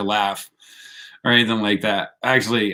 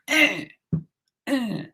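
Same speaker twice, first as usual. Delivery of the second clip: high, teasing "eh, eh"; mocking grunt; 11 semitones higher, 5 dB quieter than usual. Mocking eye-pokers with a high teasing grunt. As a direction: jab at them with a high mocking eh. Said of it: "and be like".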